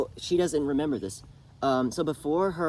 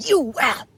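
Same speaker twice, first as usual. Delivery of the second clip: outraged cry; 11 semitones higher, 10 dB louder than usual. Retold or imitated. Mother cut off, outraged.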